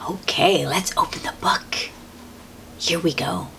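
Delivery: soft unvoiced whisper. Real, not acted. Whispering to the children as the book opens. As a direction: hush it like a secret.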